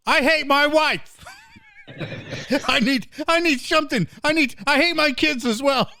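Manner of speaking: high, hammed-up whining caller; mock complainer.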